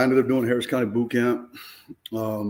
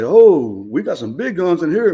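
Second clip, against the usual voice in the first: mock cop exclaim; 6 semitones higher, 8 dB louder, slower than usual. Cop finds guns in car.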